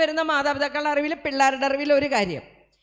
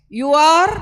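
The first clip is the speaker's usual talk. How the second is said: a big loud spelled-out reveal; shouted letters; 12 dB louder, much slower than usual. Shown as a demonstration. Spelling the word, punched out.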